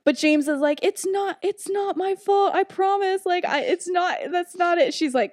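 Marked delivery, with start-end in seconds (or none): 0.8-4.9 high-pitched mock pleading; pleading protest. James denies blame to his teacher, high and pleading. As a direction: protest innocence in a high, insistent voice.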